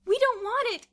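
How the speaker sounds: high, very loud refusal; sudden cry. A narrator's reading. Boots refusing the check, sudden cry. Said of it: "cried Boots suddenly".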